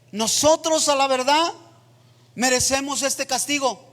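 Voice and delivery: loud, high, fervent preaching voice, near chanting; full-force preaching. Acted. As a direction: preach it out to the whole church, impassioned. Predica a toda fuerza. (predicación a todo pulmón, voz alta y ferviente)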